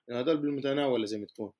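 ordinary delivery

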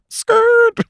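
very high squealed skrrt; imitated tire screech. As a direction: screech it like tires braking. Imitated screech as ears perk up.

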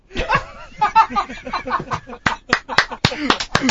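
helpless laughter. Real, not acted. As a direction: crack up uncontrollably. Cracks up after prank-call scene.

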